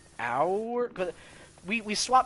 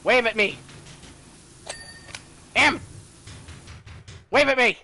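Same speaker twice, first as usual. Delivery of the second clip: loud, higher call to a friend; insistent, raised-voice call; 4 semitones higher, 4 dB louder than usual. Real, not acted. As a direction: call out for their attention. Calling to friend in game.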